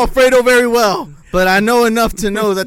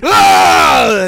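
mock scream; 13 semitones lower, 7 dB louder than usual. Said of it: "throw a shot in your face and scream".

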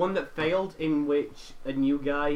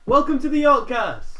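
big slow high welcome; booming podcast opener; loud announcer welcome; 9 semitones higher, 10 dB louder than usual. Acted. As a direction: announce the show like a host. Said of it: "show opening".

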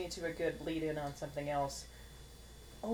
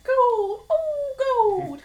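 high, loud crazed prospector cry; old-timey miner; 14 dB louder, much slower than usual. Retold or imitated. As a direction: yell it like a gold-mad old miner. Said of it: "old-timey miners all like".

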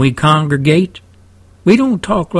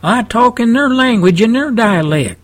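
higher-pitched South Texan twang; South Texan dialect; 7 semitones higher than usual. Shown as a demonstration. Host talking to fellow Texans, South Texan dialect. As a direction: slip into folksy South Texan talk. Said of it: "when I'm talking to my fellow Texans".

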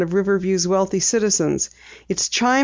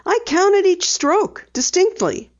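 a hint of a bright young girl, higher; light girl voice for Penny; Penny, lightly voiced; 12 semitones higher than usual. A narrator's reading. Penny telling her dad she'll drive, lightly voiced girl.

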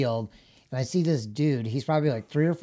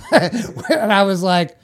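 laughing; 5 semitones higher, 6 dB louder, slower than usual. Real, not acted. Laughing retelling covered-up tattoo.

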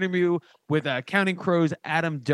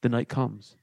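sponsor ad read; 5 semitones lower than usual. Acted. Off-the-cuff sponsor story, low and hushed.

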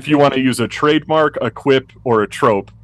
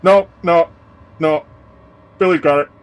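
high, dismissive cop; 7 semitones higher than usual. Acted. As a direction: brush it off, higher. NPC cop insists Billy handles.

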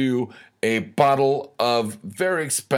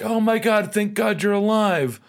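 much higher, gushing mock relief; 11 semitones higher than usual. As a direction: squeal it like a relieved pet owner. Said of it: "you're like".

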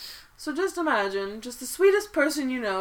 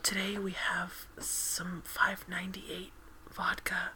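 breathy whispered ASMR read; 5 dB quieter than usual. Acted. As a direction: whisper softly into the mic. ASMR Corner whisper segment.

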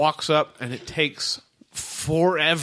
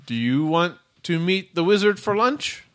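robot voice; 6 semitones higher than usual. Acted. Robot asks like phone menu.

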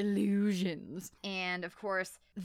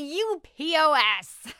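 mock sneer; 8 semitones higher, 9 dB louder, slower than usual. Mocking the boy's insult.

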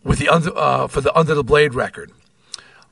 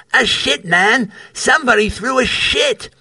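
imitating a Cockney voice, pitched higher; East London accent; 7 semitones higher than usual. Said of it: "Scottish to East London translation".

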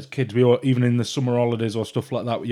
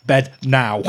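louder, curt bedtime command; parental order; 6 dB louder than usual. Retold or imitated. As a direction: order a child off to bed. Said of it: "Let me be like".

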